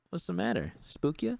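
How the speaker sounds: high, mocking taunt; sing-song gloating tease; teasing. A narrator's reading. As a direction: needle her for jumping. Rita teasing after her prank.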